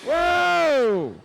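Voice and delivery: loud, high startled whoa; surprised shout. Real, not acted. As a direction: gasp it out as the tractor lifts. Tractor goes airborne.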